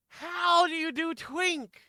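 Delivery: loud, high, drawn-out plea; exasperated. Real, not acted. Player asked to do Twink, loudly exasperated. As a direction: wail the question in helpless exasperation. Stuck finding a character's voice.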